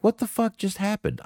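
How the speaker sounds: high-pitched, incredulous quote; bewildered disbelief. Retold or imitated. Reading Lufisto's reaction after walking out, bewildered disbelief. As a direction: read out baffled shock, voice jumping high.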